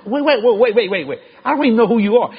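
high, laughing "wait, wait" in disbelief; can't believe the gift, laughing; excited disbelief. Retold or imitated. Stunned preacher laughing to the dealer, excited disbelief.

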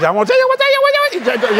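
very high, loud, excited repeats; Jerry's high excited voice. Retold or imitated. Excited friend squeaks he wants to tell something. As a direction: squeak it out, too worked up to finish. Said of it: "his voice get high too".

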